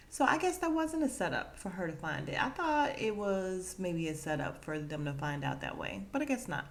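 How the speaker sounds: usual speaking voice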